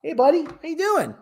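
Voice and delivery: cat talk. Real, not acted. Owner greets his cat on Cat Cam, high pet voice.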